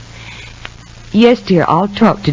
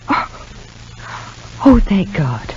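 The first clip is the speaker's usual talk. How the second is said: relieved whisper; 6 dB quieter, slower than usual. Acted. Relieved mother whispering on the phone.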